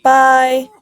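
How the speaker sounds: high, loud "bye"; breezy farewell. Acted. Duke leaving the king, breezy. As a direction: toss out a loud mock goodbye. Duke cheerfully takes his leave.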